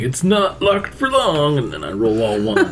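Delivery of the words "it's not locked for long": smug boast. Retold or imitated. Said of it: "because I'm like".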